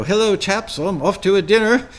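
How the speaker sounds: put-on breezy British accent, on the way out; mock British accent. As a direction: toss it off in a jaunty British accent. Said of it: "that was my really good British accent".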